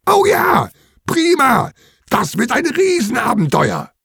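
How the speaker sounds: put-on high-pitched cheering children; jubilant. A narrator's reading. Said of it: "Die Kinder jubeln".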